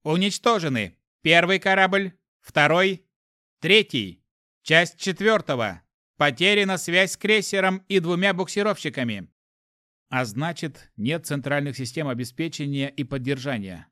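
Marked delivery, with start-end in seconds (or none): 0.0-9.3 raised, loud machine-like announcement; cold detached computer. A narrator's reading. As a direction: read the losses like an unfeeling ship computer. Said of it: "с холодным безучастным звуком".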